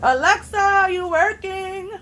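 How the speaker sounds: loud, high and drawn out; calling out. Real, not acted. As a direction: call across the room to the speaker. Calling out to the device.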